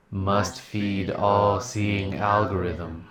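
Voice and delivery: slow robotic drone. Acted. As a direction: intone it like a machine. Machine-worship aside, slow.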